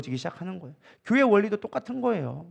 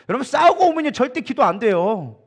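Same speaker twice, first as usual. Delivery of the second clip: emphatic preaching; 5 semitones higher, 5 dB louder than usual. Acted. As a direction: drive the point home from the pulpit.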